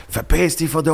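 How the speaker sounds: mock yell of someone losing it; retold freak-out. Guest retelling stranger's outburst, mock freak-out. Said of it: "komplett ausgerastet und gesagt".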